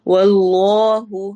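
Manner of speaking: chanted majestic واللہ; heavy lam of Allah. Shown as a demonstration. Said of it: "اس میں جلالہ ہے".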